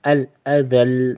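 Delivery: ال، اذل slow, the l lightly stretched; slight lengthening. Shown as a demonstration. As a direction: draw out the l in اذل a little. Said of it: "ہلکا سا کھینچنا ہے". (اذل میں لام ہلکا سا کھینچیں)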